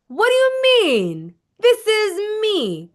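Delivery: high, loud, slow disbelief; audacious. Shown as a demonstration. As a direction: act offended anyone doubts it. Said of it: "Cue in your audacity".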